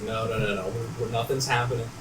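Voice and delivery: soft, low placating murmur; retold placating. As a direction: back off quietly, trying to calm him. Placating a man with a knife.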